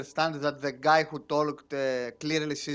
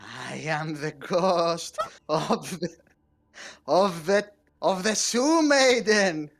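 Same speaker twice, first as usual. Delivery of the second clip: spooky ghost voice; slower than usual. Acted. Player speaks as spooky ghost.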